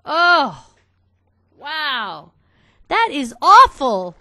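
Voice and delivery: loud, high shocked cry of dismay; real shock, half laughing it off. Real, not acted. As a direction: cry out at the bad result. Seeing a bad hatch result.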